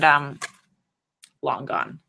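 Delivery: ordinary talk, nothing marked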